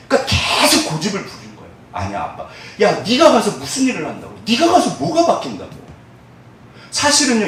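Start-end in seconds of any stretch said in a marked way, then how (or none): 1.9-2.5 low, subdued reply; lightly voiced child answering back, low and soft; child's reply to father. 2.8-5.7 higher-pitched, exasperated challenge; mock scolding parent; acted remonstrance. Acts father scolding, dissuading.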